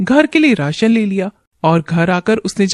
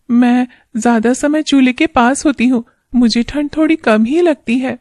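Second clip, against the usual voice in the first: put-on higher, worried young wife; wife's voice; 5 semitones higher than usual. Wife worried about husband's early work, higher wife voice. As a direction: speak as a caring wife fretting over her husband.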